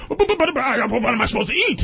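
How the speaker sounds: a lightly voiced stammering shark, rushed; flustered shark. Shark protesting to the man, lightly voiced rushed stammer. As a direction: sputter fast like a confused shark. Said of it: "the shark's sitting there going".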